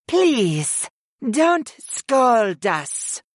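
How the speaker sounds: high, drawn-out pouty plea; pouting. A narrator's reading. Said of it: "Utuni pouted".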